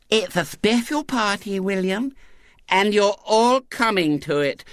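put-on high lisping little girl, decided; tone of finality. A narrator's reading. Girl informing the boys about her party, final. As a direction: declare it settled in a child's voice. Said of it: "in a tone of finality".

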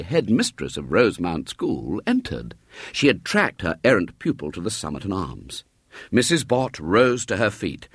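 ordinary delivery, nothing marked

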